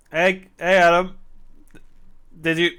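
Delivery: calling out. Real, not acted. Player calling Adam about the punch, loud call. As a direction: call across to get his attention.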